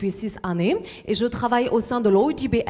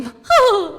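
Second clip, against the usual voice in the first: loud high laugh; 14 semitones higher, 9 dB louder than usual. Real, not acted. Laughs at her own joke.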